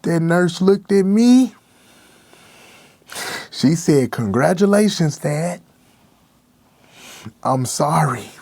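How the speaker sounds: fighting tears as the words come out; voice breaking. Real, not acted. Breaks down recounting stillbirth.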